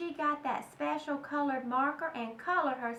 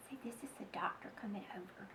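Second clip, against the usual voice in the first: fast, voiceless whispered aside; hushed explanatory aside; 6 semitones lower, 14 dB quieter, much faster than usual. Real, not acted. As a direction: whisper a quick note to listeners.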